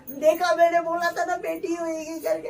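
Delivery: high, loud triumphant crowing; excited told-you-so. Real, not acted. Wife in wheelchair tells husband she was right, excitedly. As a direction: gleefully rub it in.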